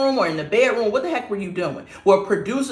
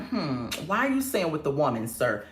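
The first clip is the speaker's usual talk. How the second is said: low, hushed, suspicious query; questioning suspicion; 6 semitones lower, 9 dB quieter than usual. Acted. Commentator questioning a man's odd wording, quietly skeptical. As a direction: ask it softly with a question mark hanging. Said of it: "I have a question mark like".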